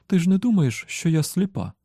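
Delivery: high-pitched, testy wife; indignant retort. A narrator's reading. Wife retorting that she is not blind, indignant. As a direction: raise the pitch in mild indignation.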